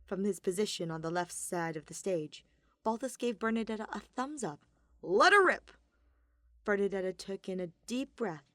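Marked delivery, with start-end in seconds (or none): 5.0-5.7 loud, high cheer; shouting. Acted. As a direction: yell it out with gusto. Balthus shouts encouragement.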